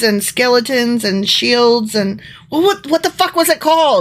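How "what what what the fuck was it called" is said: exasperated. Real, not acted. Still cannot recall the name.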